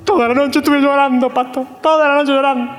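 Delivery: a touch of fake tearful whining; lightly hammed-up sorrow, pleading to the pastor; mock weepy complaint. Congregant pleading to the pastor, mock tearful. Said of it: "el otro viene y lo ojo así".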